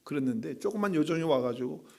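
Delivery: ordinary talk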